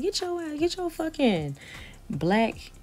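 high, sharp shove-off; furious dismissal. Retold or imitated. Telling the sneaker guy to leave, high and scornful. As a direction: send him away with contempt.

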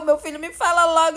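normal talking voice

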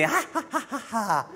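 high, creepy imitated laugh; high-pitched fake cackle in separate bursts; mock mechanical-sailor laugh. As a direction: imitate a terrifying laughing-policeman dummy. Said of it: "The audio is terrifying".

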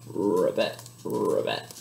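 very low croaking frog voice. Pretend. Librarian voicing the frog for kids, deep croak.

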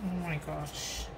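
low, soft, breathless oh my gosh; awed murmur. Real, not acted. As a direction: murmur it in hushed awe. Awed murmur over gift.

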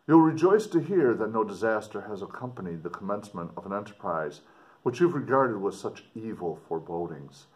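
dramatic reading. Acted. Teacher reading the explorer's letter aloud to students, expressive. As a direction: read it out to the class with feeling.

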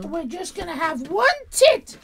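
put-on high-pitched gushing girl voice; giggly teenage girl. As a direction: play the overexcited teen streamer, high. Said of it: "do it more like the Giggly Girls".

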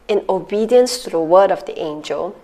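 ordinary talk

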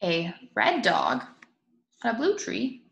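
ordinary delivery

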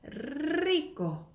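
"리꼬" opening on a held trill; slow "리꼬" with an extended trill; long rolled r. Shown as a demonstration. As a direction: roll the r at length before finishing "리꼬". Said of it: "이런 식으로".

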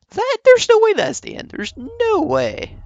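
high, stunned cry of disbelief; can't believe it's over. Real, not acted. Shocked at the short game.

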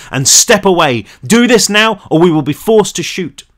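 final warning, hammer it; police shout. A narrator's reading. Cop giving a final warning, loud shout. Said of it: "the man shouted".

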